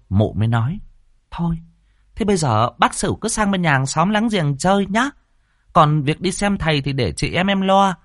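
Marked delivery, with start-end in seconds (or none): 1.3-8.0 high-pitched, managing village woman; high, brisk-minded helper giving arrangements; busybody neighbour. Acted. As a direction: take charge in a raised-pitch neighbourly voice. Voices the neighbour Đoan giving orders.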